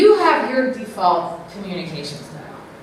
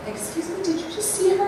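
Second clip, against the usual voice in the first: soft, higher snide aside; much softer, higher snide remark to onlookers; passive aggressive; 8 semitones higher, 7 dB quieter than usual. Shown as a demonstration. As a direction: make sure others hear the jab. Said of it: "passive aggressive".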